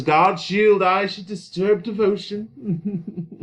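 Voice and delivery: high, loud, slow smug drawl. Acted. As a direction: savour your own smugness. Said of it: "smell the smugness coming off of him".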